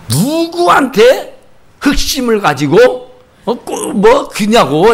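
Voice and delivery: drawn-out, accusing mock tirade; jealous scolding. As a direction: imitate a jealous husband, stretching the words slowly. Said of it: "하면서 야단을 하는 거야". (질투하는 남편처럼 길게 늘여 야단치기)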